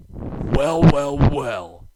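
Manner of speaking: loud, drawn-out sneer. A narrator's reading. Harry mocking Thomas at the docks, sneering. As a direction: gloat over Thomas. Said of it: "sneered Harry".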